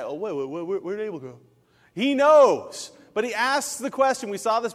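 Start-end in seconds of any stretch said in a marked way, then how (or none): 0.0-1.4 lightly voiced flustered searcher; mock distracted God. Joking God lost track of Abel, mock distracted. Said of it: "he's like". 1.9-2.9 loud and high on knows; emphatic point. Acted. Preacher stressing God's knowledge, loud emphatic.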